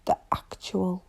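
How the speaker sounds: whispered "What the actual?"; whispered disbelief. Retold or imitated. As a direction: hush it out in disbelief. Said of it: "on my couch and be like".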